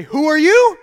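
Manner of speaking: stern mom. Retold or imitated. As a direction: make the kid answer for himself. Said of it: "point that finger back at me and say".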